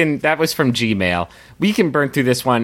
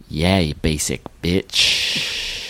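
slow, lower, drawn-out put-down; sassy Brutus; 6 semitones lower, much slower than usual. Acted. Brutus answering dying Caesar, slow and dismissive. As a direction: stretch the insult out with cool contempt. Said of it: "If Brutus was like".

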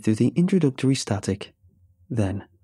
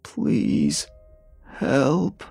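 drawn-out rasped plea, right on the mouthpiece; strained, close-up plea; 4 semitones higher than usual. A narrator's reading. Old caller suddenly at the worker's ear, strained and close. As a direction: rasp it straight into the receiver. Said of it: "placed the receiver to his lips".

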